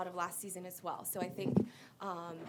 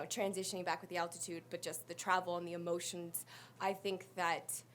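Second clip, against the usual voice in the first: ordinary talk, nothing marked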